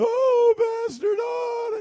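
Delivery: high, loud wailing voice; distressed man. Retold or imitated. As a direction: wail it like a desperate man.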